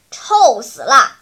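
lightly mocking impression of a whiny girl; loud mock complaint about the stink; mimicking Jiaojiao. A narrator's reading. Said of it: "学着娇娇的语气说道".

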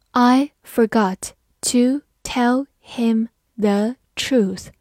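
slow, word by word with gaps; slow reading. Shown as a demonstration. Teacher leads learners through the first sentence, slowly. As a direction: read it slowly, pausing after each word. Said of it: "好，慢慢来读".

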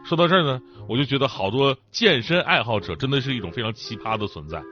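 normal talking voice

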